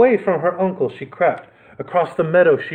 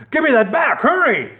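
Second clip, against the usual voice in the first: much higher panicked cry; urgent cry; 11 semitones higher than usual. Acted. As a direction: cry out in alarm. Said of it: "he cried".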